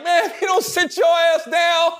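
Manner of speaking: high, slow mock yell; shouting. Brothers yelling at the heckler, high mock shout. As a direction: yell him back into his seat. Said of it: "They was like".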